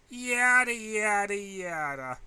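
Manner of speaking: high mocking singsong, fading as it goes; mocking yada. A narrator's reading. Bored student mocking teacher's review, high singsong fading out. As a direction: brush off the lecture in a bored mocking lilt.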